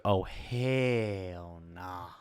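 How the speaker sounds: drawn-out, low hell nah; slow disbelief. Retold or imitated. Wife quoted refusing the charge, slow and disbelieving. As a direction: stretch it out, unimpressed. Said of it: "she was like".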